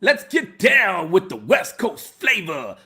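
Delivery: drawn-out hype shout kicking off the set. Acted. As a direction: announce it like a hype man to the room. Hypes start of music segment.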